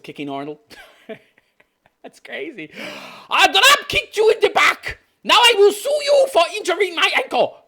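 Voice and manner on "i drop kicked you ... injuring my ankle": high, loud put-on declaration; hammed-up shouted announcement in a high voice; mock attacker. Reactor playing the attacker threatening to sue Arnold, mock declaration. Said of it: "Kick him and then try to sue Arnold".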